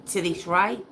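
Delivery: normal talking voice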